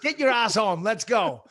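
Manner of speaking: loud, high retold scolding; telling off. Dad retelling how he ordered his daughter home, scolding. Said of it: "telling my daughter off".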